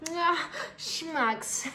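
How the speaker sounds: put-on high cute anime girl squeal. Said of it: "like, an anime voice".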